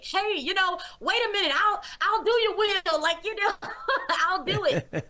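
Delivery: high, laughing, desperate yes; panicked eager agreeing. Retold or imitated. Man rushing to agree to the Lord's will, high and laughing.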